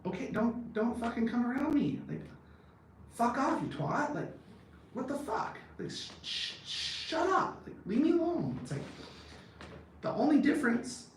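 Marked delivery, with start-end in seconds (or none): 0.1-8.6 worked-up pretend outburst, voice ever higher; mock angry rant. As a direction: pitch climbing higher and higher in a hammed-up rant. Ranting at imagined offended people.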